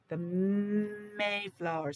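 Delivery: "The Mayflower" stretched out slowly, every syllable held; slow teacher drawl. Shown as a demonstration. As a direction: say "The Mayflower" slowly so kids catch the first sound. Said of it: "what do you think Mayflower would start with".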